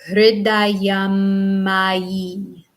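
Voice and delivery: Hridayam ma yi slowly, long held M in the middle; slow recitation. Shown as a demonstration. Teacher leading students through a slow Hridayam ma yi. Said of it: "Once more".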